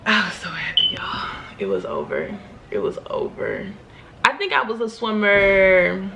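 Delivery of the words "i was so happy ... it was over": breathy whispered sigh of relief, much lower and softer; whispering it was over; hushed relief. Real, not acted. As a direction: let it out like the weight finally lifted. Relief after passing swim test.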